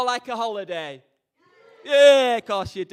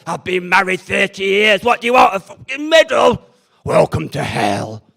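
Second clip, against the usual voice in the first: grumpy married bloke; 9 dB louder than usual. Retold or imitated. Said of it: "a guy over there who said".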